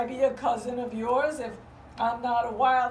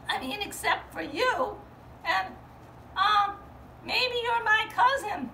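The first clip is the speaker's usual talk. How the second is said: high, excited lamb voice; a small eager lamb looking for family; little sheep; 7 semitones higher than usual. Acted. Little sheep searching for her cousin, high and eager.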